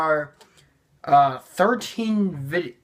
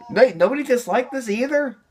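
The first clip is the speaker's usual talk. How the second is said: surprised; 7 semitones higher than usual. Real, not acted. Reacting to his old dub.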